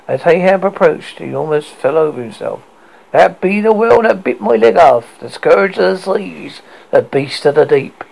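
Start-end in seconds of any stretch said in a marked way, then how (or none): 3.1-8.0 put-on raised, lightly grizzled old captain; hint of a pirate captain. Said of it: "grizzled voice".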